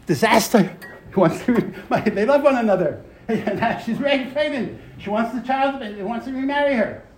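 high-pitched, half-laughing storyteller's alarm; exclaimed dismay. Retold or imitated. Teacher telling class of the couple's disaster, exclaimed dismay. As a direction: pitch climbs as the predicament piles up.

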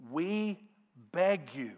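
drawn-out, emphatic plea from scripture; earnest pleading. Acted. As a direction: read it as a weighty appeal. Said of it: "you're begging and you're pleading".

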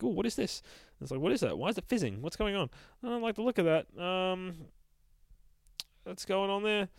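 high-pitched, bewildered little monster voice; puzzled ghouls. Acted. Ghouls puzzle over the fizzing dynamite, high-pitched bewilderment. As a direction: ask it high and uneasily.

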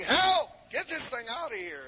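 high mock scream that falls off in volume; imitated screaming. Preacher mimicking women fleeing a moth, imitated screaming. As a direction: act out a panicked cry for help. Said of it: "They were yelling, screaming".

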